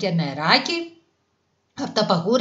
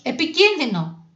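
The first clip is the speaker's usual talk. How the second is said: high-pitched alarmed scolding; teacher warning sternly of danger; 7 semitones higher than usual. A narrator's reading. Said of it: "αγρίεψε ο δάσκαλος". (αυστηρή προειδοποίηση)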